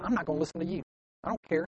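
soft, dismissive aside; hushed acted grudge; sulky refusal. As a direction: shut them out quietly.

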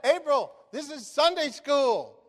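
high, loud, slow proclamation; speakerphone announcement. Retold or imitated. Retold announcement over speakerphone.

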